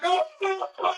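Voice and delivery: hammed-up horror at the news; mock dismay. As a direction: dread rising in the voice. Dismay at possible pairing.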